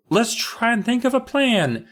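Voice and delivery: high-pitched imitation of naive children; mock kid voice. Trapped kids trying to plan, high mocking child voice. Kids' line acted in high voice.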